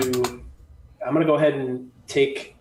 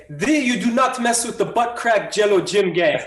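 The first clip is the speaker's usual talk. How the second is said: high, hammed-up battle boast; mock-grand warning; 8 semitones higher, 3 dB louder than usual. Archer to the felled thug, mock-grand warning. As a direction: proclaim it with a laugh underneath. Player's character warns fallen thug.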